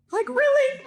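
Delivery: very high, incredulous "really"; disbelief. Retold or imitated. Stuck outside the car, high disbelief. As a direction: squeak it in disbelief. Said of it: "I'm like".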